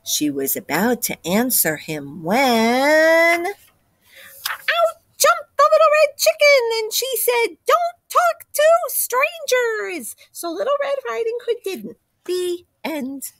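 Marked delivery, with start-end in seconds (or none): 3.5-13.3 high, breathless little chicken voice; excited high chicken voice. Acted. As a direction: burst in as an eager little chicken. Chicken bursts into the story.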